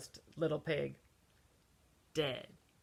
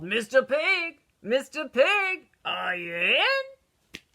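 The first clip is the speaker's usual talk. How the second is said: loud, slow sing-song call; 6 semitones higher, 8 dB louder, slower than usual. Retold or imitated. Wolf calling to the third pig, loud and drawn out. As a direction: draw out each word to the house. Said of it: "I called".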